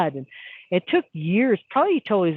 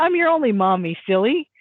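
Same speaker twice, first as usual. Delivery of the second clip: playful teasing; 6 semitones higher, 4 dB louder than usual. Retold or imitated. Mom teasing her toddler.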